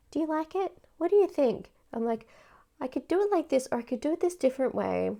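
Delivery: high sing-song questions to a pet; asking the cat. Retold or imitated. Showing the cat her crochet, high and cooing. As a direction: coo the options at the cat.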